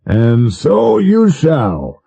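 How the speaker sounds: slow, indulgent promise. Acted. President promising the prince a coach, slow and indulgent. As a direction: grant the wish like an indulgent host. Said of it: "the president said indulgently".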